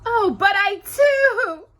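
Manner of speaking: high, slow, loud, stubborn Cinderella; insisting. Acted. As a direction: dig in against her comfort. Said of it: "Cinderella insisted".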